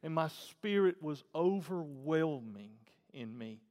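usual speaking voice

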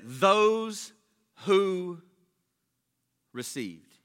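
"those", "who", "received" separated by long pauses; word-by-word emphasis. Real, not acted. Preacher focusing on the verse, "those who received" broken by pauses. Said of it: "What I wanna focus on is".

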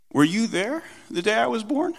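high-pitched, rising challenge; retold dad quizzing a toddler; pointed rhetorical question. Dad challenging his three-year-old, pointed questioning.

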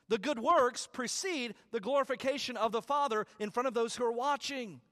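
loud, high preaching build; preaching at full force. Acted. Pastor pressing the verse on the congregation, rising preaching. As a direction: lift the voice and preach it home.

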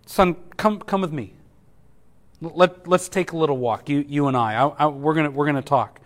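soft and low, a father calling his son; tender. Acted. Pastor voicing God tenderly to Elijah, soft and low. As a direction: speak gently, drawing him close.

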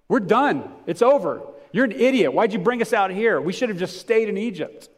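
high, loud panicked yelling at Moses; yelling complainers. Acted. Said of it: "they're screaming and yelling at Moses".

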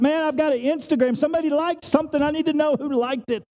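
high-pitched, hammed-up eager phone user; mock urgency. Preacher mimicking someone hitting refresh on Instagram, mock urgency. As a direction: play someone desperate to see a notification.

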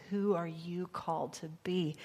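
normal talking voice

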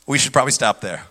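ordinary delivery

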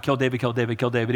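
low, repetitive mock mutter; one-track mind. Saul obsessed with killing David, single-minded low chant. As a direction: repeat it low like a fixed obsession. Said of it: "he's got one track mind".

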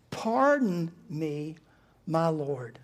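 very slow, spaced-out "Pardon me, my Lord"; measured, halting address to a master; deliberate address. Acted. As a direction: draw out "Pardon" and pause before "my Lord". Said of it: "look how Gideon talks to him".

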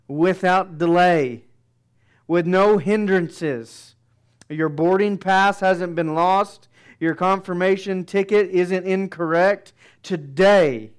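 emphatic sermon climax; preaching build. Acted. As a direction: drive each assurance home. Preaching at full force.

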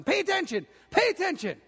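high, pushy yell of a sideline dad; impatient dad yelling from the stands; pushy Little League dad calling out; retold yelling. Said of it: "he keeps yelling at his son".

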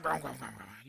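word sinking into a low mutter; fading imitation of a grumbler; grumbling. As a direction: let "grumbling" decay into a mumble. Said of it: "That's what grumbling is".

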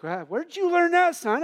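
high, amazed voice of the dad; surprised dad. Retold or imitated. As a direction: gasp it out, delighted then shocked. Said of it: "the dad's like".

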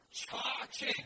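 loud demo of "Cha-ching" for the congregation. Shown as a demonstration. Preacher leads the crowd in chanting the word, loudly. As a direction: belt out "Cha-ching" as a joyful call. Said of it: "put them together".

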